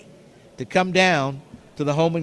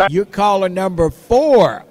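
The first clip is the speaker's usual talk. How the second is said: loud caller count; calling out; 4 semitones higher, 7 dB louder than usual. Acted. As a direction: belt out the number.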